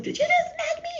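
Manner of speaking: shocked she got slapped; indignant disbelief. Retold or imitated. Elf-self asking the guide after a slap, indignant. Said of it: "I was like".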